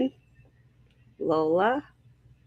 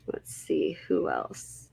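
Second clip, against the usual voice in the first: whispering to herself while scanning the list; whispered aside; 9 dB quieter, slower than usual. Real, not acted. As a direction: drop to a breathy whisper, thinking aloud. Muttering to herself while reading names.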